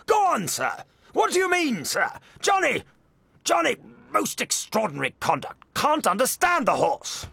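very high, blustering pompous outrage; high-pitched comic imitation of an affronted old man; pompous, indignant old gentleman. A narrator's reading. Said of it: "was a very pompous old gentleman, was highly indignant".

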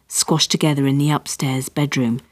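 ordinary delivery

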